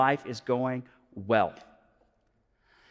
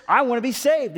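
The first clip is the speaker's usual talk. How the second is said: 1 eager plea; 7 semitones higher, 4 dB louder than usual. Retold or imitated. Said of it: "And he says".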